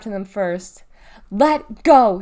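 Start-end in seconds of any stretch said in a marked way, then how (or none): 1.3-2.2 very loud sharp command; hollering. Acted. Mom hollers at Deli to let go of her sister, hollering. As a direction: holler to break up the fight. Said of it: "she hollered".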